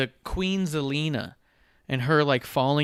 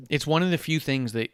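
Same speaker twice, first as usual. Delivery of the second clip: usual speaking voice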